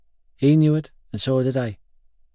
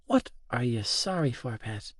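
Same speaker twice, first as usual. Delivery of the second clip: put-on very soft old woman; hushed and fond; tender question; 9 dB quieter than usual. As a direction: ask it gently, fading away. Tender Nanny answers apology.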